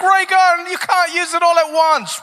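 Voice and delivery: high, urgent plea; mock alarmed warning. Comedian as onlooker warning the breakdancer, in high mock alarm.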